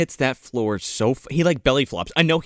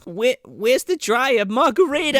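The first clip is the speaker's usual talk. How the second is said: dotty mom; 10 semitones higher than usual. Retold or imitated. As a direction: imitate a muddled mom looking for things, pitched up. Acted dotty mom from movie.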